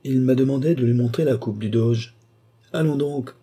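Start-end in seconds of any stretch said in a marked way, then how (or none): 2.7-3.4 higher, startled exclamation. A narrator's reading. Detective startled that the count showed the cup, higher. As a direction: jump at the news, lightly. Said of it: "sursauta le policier".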